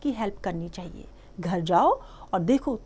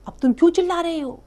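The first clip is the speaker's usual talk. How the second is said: scolding; 8 semitones higher, 7 dB louder, faster than usual. Retold or imitated. Teacher re-voices the duck's scolding.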